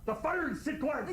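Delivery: very high, frantic plea; frantic, almost shrieking; desperate Kirk. Acted. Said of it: "I'm gonna do Kirk".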